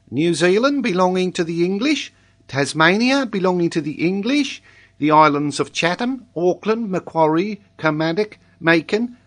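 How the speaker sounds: put-on high singsong child recital, lifting at each item; reciting schoolboy. A narrator's reading. Boy recites possessions to his examiner, high schoolboy voice. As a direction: rattle off a learnt lesson.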